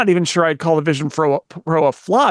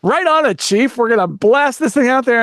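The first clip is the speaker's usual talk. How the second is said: eager, higher-pitched yes-man voice; mock can-do enthusiasm, pitched up; gung-ho underling; 7 semitones higher than usual. Apple employee answering the boss about a launch, mock gung-ho.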